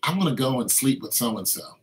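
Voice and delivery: soft, coaxing murmur of a tempter; tempting inner voice. Acted. Preacher voicing a tempting spirit, hushed and coaxing. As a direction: lure the listener in quietly.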